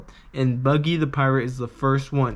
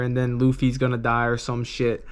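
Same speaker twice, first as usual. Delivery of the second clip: normal talking voice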